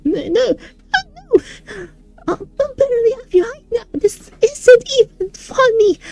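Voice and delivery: panicked, near tears. Acted. Panicking about shrinking.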